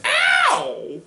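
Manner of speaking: very high, loud "ow"; cartoon squeal of pain; mock pained yelp. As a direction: shriek it like a squeaky nerd. Urkel reacts to being touched.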